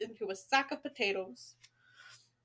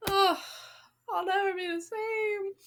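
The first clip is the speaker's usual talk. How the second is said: hammed-up tearful wail, higher; mock crying; 6 semitones higher than usual. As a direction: pretend to be heartbroken. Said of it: "I'm just kidding".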